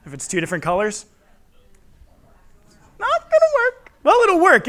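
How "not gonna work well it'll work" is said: high squeak of mock alarm; squeaky high voice. Comic squeaky self-correction.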